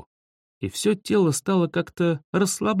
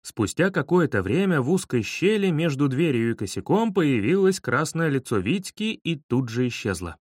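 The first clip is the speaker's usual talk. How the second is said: plain reading voice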